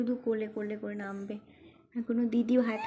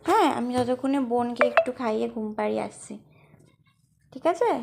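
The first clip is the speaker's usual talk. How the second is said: higher, louder call across the house; mother calling her son to eat, raised voice; 3 semitones higher, 6 dB louder than usual. Real, not acted.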